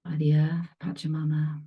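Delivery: calm meditation guide with long pauses; spacious guided-meditation voice, leaving silence between phrases. Real, not acted. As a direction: ease them into stillness, phrase by phrase. Leads a meditation.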